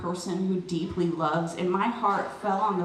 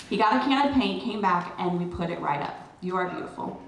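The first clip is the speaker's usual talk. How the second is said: ordinary talk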